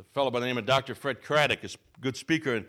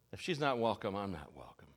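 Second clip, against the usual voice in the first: lower, softer repeat of "If she's not welcome, I'm not welcome", fading away; quiet; 7 semitones lower, 8 dB quieter than usual. Shown as a demonstration. Preacher softly repeating the stranger's words to the congregation, quiet. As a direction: let the line sink in softly. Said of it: "This attitude of".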